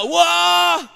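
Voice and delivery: a sudden very high, very loud howl; demon howl. Retold or imitated. The demon answering the psalm with a shrieking howl. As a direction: howl like a beast struck by the verse. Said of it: "el demonio gritaba".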